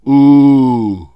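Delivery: U said with tongue hanging out, loud; tongue sticking out. Shown as a demonstration. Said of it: "und dann U".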